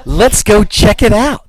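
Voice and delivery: hammed-up curious teenager calling to friends; movie teenagers. Host voicing teenagers who see a falling star, slow and hammed-up. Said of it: "and they go".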